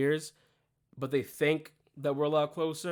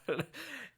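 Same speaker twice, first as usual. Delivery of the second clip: burst of laughter; 3 semitones higher, 8 dB quieter than usual. Real, not acted. Losing it at the joke.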